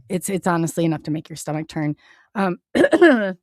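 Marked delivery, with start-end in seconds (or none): 2.4-3.4 fighting back real tears as she apologizes; getting emotional. Real, not acted. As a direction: voice rising, choked up and louder. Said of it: "I get a little emotional".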